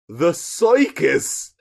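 "circus" said as "soy-kis"; drawn-out "circus" with an oy vowel, imitating the singer; mimicked mispronunciation. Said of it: "that's exactly how she said it".

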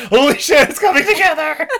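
high, loud, laughing excitement; excited. Real, not acted. Podcaster realising the joke plot works, real excitement. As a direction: burst out in delighted revelation.

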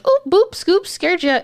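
high, playful mock scare; squeaky fake jump scare; silly spooky voice. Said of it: "But it wasn't even like".

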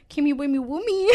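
put-on high silly baby talk.

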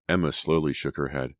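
usual speaking voice

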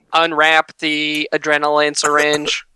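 slow monotone device instructions; robot voice. Acted. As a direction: recite it slowly like a robot. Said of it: "a robot voice".

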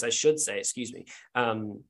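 ordinary delivery

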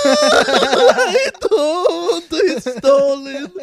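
very high, loud, slow wail; mock bawling. Suspect confessing to police about the gun, mock bawling. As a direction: howl it out in exaggerated sobs. Acted tearful confession of theft.

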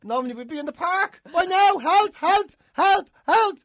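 high, loud yelping cries for help; panicking dog. Acted. Dog at the window thinks owner is dead, mock-panicked yelling. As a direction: shout in short frantic bursts, like a hammed-up dog.

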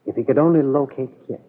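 ordinary delivery

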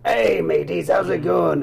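loud hammed-up pirate greeting; wannabe pirate. Poser greeting real pirates, loud hammed-up hail. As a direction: hail them like an over-eager fake pirate. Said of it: "he rolls up and meets the real pirates".

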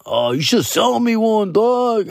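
drawn-out mock drunk pleading; drunk fan impression. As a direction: imitate a tipsy fan angling for a favour. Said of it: "They were kinda drunk".

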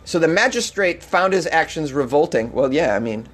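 ordinary delivery

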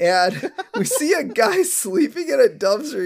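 cracking up mid-sentence, pitch climbing; laughing while talking. Real, not acted. Podcaster retelling the dumpster scene, laughing through it. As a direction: tell it through rising laughter.

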